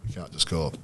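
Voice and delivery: ordinary delivery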